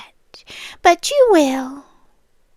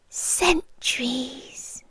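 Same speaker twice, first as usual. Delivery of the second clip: whispered; 10 dB quieter, slower than usual. Acted. Answers her own question.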